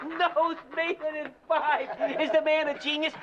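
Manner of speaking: high, tickled repeat of a joke; delighted admiration. Acted. Corporal praising comedian's joke about him, delighted admiration.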